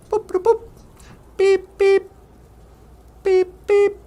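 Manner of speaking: high robot boops and beeps; the ship signals it's home; robot computer beeps. Acted. Spaceship computer beeps as the pilot returns home.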